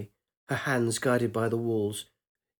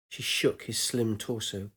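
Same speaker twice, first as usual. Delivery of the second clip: ordinary delivery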